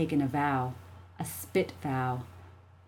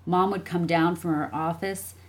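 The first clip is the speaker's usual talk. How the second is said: plain reading voice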